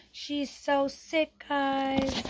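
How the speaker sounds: slow high pitying drawl. Real, not acted. Mom tells viewers her daughter is sick, slow and pitying. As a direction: stretch it out in pity.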